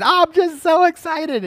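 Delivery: very high, loud mock-thrilled squeal of words; gushing excitement. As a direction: act barely able to contain the thrill. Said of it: "He's so excited about us".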